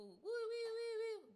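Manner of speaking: a high, playful ambulance siren "woo woo"; siren noise. Retold or imitated. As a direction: imitate the siren coming closer.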